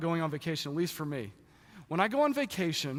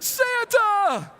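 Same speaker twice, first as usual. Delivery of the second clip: much higher, much louder ecstatic elf yell; Buddy the Elf; 9 dB louder than usual. Retold or imitated. Excited elf spotting Santa, ecstatic yell. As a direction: yell it with wild childlike joy. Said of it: "Kinda like Buddy the Elf".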